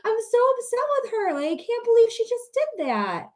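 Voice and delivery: high, quick put-upon mom voice; mock disappointed mother, fast and high. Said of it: "a disappointed mom voice".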